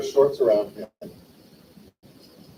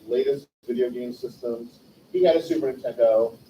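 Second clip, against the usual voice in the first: ordinary talk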